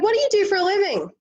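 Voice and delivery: high, quick, bubbly American tourist; American accent. Retold or imitated. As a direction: pipe up brightly in a put-on American accent. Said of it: "this is my American accent".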